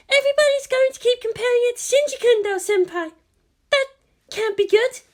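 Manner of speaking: put-on young Maya voice. A narrator's reading. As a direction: play the eager junior assistant, high and bright.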